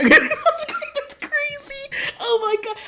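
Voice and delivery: very high, squealed through laughter; real helpless laughter. Real, not acted. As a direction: laugh so hard the words squeak. Cracks up at own remark.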